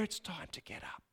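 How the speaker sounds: gentle breathy whisper into her ear; soft whisper. Acted. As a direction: whisper it softly, so as not to startle. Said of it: "you quietly whisper in her ear".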